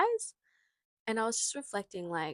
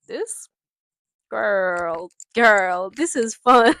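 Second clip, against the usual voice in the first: loud, gleeful outburst breaking into laughter; excited exclamation; 14 dB louder, much slower than usual. Real, not acted. Vlogger loving the new little mic, loud excited outburst. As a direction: belt out your delight, rising at the end. Delighted playing with the microphone.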